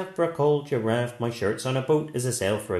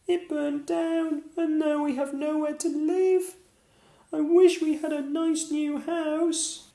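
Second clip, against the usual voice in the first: squeaky mouse. Acted. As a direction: squeak like a tiny mother mouse. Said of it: "squeaked the mother mouse".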